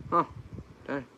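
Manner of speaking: normal talking voice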